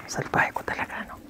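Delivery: playful whisper aside. Real, not acted. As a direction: whisper it like a guilty joke. Teacher jokes about being naughty.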